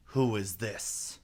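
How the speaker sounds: slow, stern royal demand; a queen looking down on a stranger; severe queen. A narrator's reading. Queen demands sternly who the child is. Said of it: "the queen said severely".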